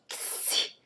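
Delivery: "Ksi" breathed out with no voice, k then s; whispered ksi. Shown as a demonstration. Teacher isolating the X sound as "Ksi". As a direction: hiss "Ksi" as bare sounds. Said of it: "Olha como que a gente fala o X".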